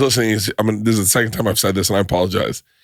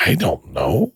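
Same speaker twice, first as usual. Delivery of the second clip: put-on evasive comic voice; lightly voiced cagey celebrity; Gilbert Gottfried impression; 3 semitones lower, slower than usual. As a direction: play dumb in a character voice. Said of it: "And he goes".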